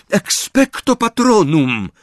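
high, slow, unbroken spell; focused incantation. A narrator's reading. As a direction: cast it slowly and steadily, pitched high. Retried spell after told to concentrate.

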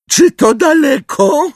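very high, loud, drawn-out figure; Umbridge voice, impatient. Acted. Umbridge asks on the way through brambles, high voice. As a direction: squeal the question slowly.

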